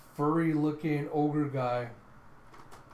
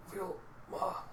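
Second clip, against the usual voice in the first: soft, breath-only feeble call; weak dying voice; 5 semitones higher, 7 dB quieter than usual. Retold or imitated. Frail grandpa seeing Vilma after fifty years, weak whisper. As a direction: barely get the name out. Withered old man, shakily reaching.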